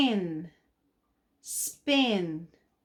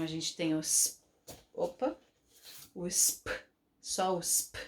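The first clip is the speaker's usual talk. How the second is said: ordinary talk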